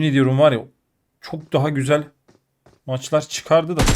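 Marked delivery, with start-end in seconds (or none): none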